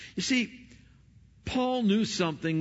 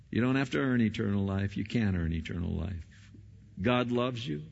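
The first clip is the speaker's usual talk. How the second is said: usual speaking voice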